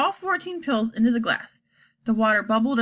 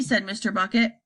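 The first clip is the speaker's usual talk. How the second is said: usual speaking voice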